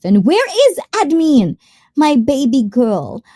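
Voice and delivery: high, loud call for someone missing; playful calling out. Pretend. Calling out for absent admin.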